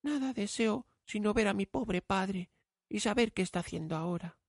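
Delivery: put-on high, soft girl's voice; a longing girl speaking to herself; young girl voice. Bella's voice in the story.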